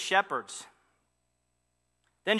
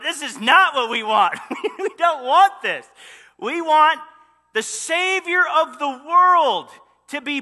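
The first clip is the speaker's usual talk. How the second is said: loud, high, laughing complaint about the plan; hammed-up indignant demand for a royal birth; laughing protest; 5 semitones higher, 5 dB louder than usual. People protesting to God, laughing loud indignation.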